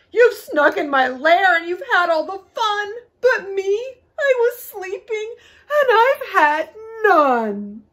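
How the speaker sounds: loud, high, aggrieved bear voice; hammed-up tearful complaint, loud and high; wailing bear. A narrator's reading. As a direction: wail it like a left-out storybook bear. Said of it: "he whimpers and he moans, he wails".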